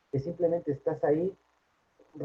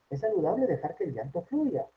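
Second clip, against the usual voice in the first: ordinary talk, nothing marked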